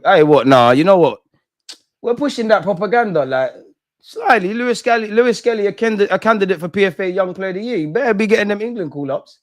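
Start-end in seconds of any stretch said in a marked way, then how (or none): 2.0-9.4 high, lively hype for a player; excited hype. Real, not acted. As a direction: talk him up with energy. Hyping a player, raised voice.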